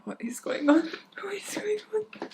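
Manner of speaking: hushed unvoiced whisper; breathy secret whisper. Real, not acted. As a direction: whisper it in disbelief. Whispered question to friend.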